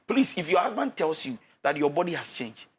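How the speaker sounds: ordinary talk, nothing marked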